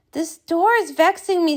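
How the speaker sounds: ordinary delivery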